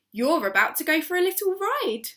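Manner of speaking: playful tease. A narrator's reading. Mister Hoppy lifting Alfie up, playfully teasing. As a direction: tease the tortoise gleefully.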